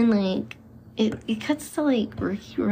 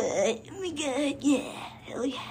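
higher, slower thrilled exclamation; excited friends; 6 semitones higher than usual. Retold or imitated. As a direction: gush like a thrilled teen. Friends thrilled by the Ouija board.

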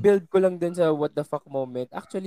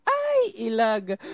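ordinary delivery

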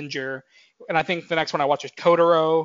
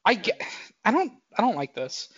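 ordinary delivery

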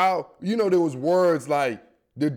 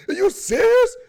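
much higher, loud shout of disbelief; incredulous shout; 6 dB louder than usual. Real, not acted. Reacting to the Titans' lineup, an incredulous high shout. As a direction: yell it like you can't believe it.

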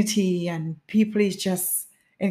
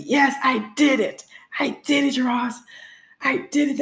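high, elated exclamation; amazed triumph; 6 semitones higher than usual. Retold or imitated. Runner who has finished her marathon, amazed triumph. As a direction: celebrate the win in a raised voice. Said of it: "it was just feeling so amazing".

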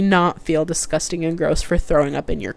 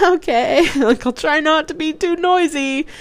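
laughing reply; 10 semitones higher than usual. Retold or imitated. Laughing reply to car sick sibling.

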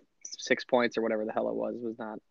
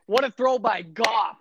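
high, drawn-out mock cheer; mock all-caps hype; 7 semitones higher than usual. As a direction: imitate an over-excited fan typing in capitals. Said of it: "all caps".

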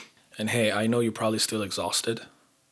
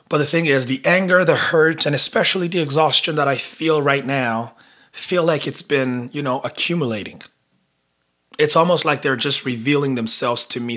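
louder, high and charged with anger; raw anger; 4 semitones higher, 7 dB louder than usual. Real, not acted. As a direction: let the built-up anger burst out. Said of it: "I know I'm angry".

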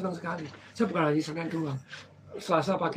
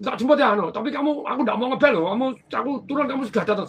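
bossy customer; 6 semitones higher, 5 dB louder, faster than usual. Retold or imitated. Voicing the customer's orders.

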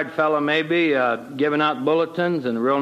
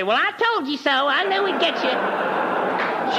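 much higher, very slow gloating wife voice; mock gloating wife; 10 semitones higher, slower than usual. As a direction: ham up the smug "told you so". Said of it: "My wife didn't say".

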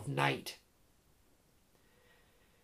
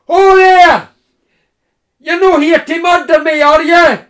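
booming Scottish bellow, much higher and louder; bellowing cry; 10 semitones higher, 17 dB louder than usual. Acted. Woodsman calling out to Jim from afar, bellowing. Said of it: "The bellowing cry came".

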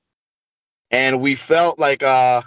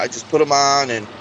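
ordinary talk, nothing marked

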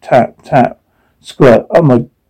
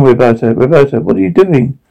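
usual speaking voice

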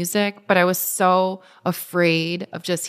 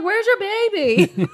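high, drawn-out mock crowd question; imitating a baffled, delighted spectator; excited onlookers; 13 semitones higher, slower than usual. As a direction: call it out slowly, way up high. Crowd exclaims at pregnant runner.

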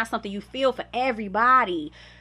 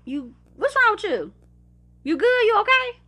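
dad checking in; 11 semitones higher, 3 dB louder than usual. Retold or imitated. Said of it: "he would call my phone and be like".

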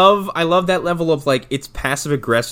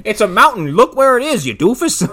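higher, louder put-on impatience; mock exasperation; 9 semitones higher, 5 dB louder than usual. Shopkeeper to a lost customer, mock exasperated. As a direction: point out the obvious to an idiot.

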